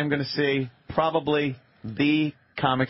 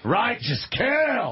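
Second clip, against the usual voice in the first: slow, drawn-out title read, pitched higher; slow dramatic; 9 semitones higher, much slower than usual. Acted. Repeating the show title back, slow and dramatic. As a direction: savor the name like a movie trailer.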